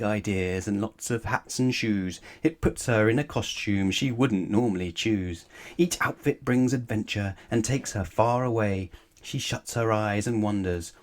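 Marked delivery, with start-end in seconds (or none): none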